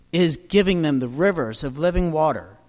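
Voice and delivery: ordinary talk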